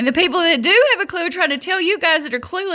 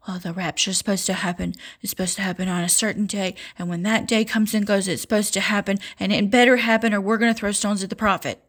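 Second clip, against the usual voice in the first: low, quieter voice imitating naive believers; mimicked believers; 5 semitones lower, 4 dB quieter, faster than usual. Mimicking watchmen who expect the rapture on schedule, low mock voice. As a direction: drop low and softer, mocking their insistence.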